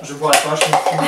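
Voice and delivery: loud, defiant refusal. Real, not acted. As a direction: shout it out, stubborn. Refusing the beer forfeit.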